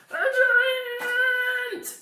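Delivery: sudden huge shout; dramatic loud reveal of a giant; booming giant reveal. A narrator's reading. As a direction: boom out the giant like a shock. Giant appears in read story.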